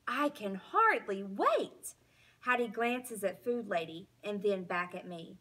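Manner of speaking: ordinary delivery, nothing marked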